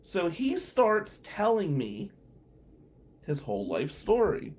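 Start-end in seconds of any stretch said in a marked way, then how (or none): none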